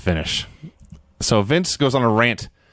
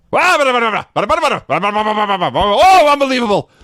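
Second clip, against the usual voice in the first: high, louder mock exclamation; hammed-up outburst of disbelief; imitating a snap; 6 semitones higher, 5 dB louder than usual. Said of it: "so he snaps".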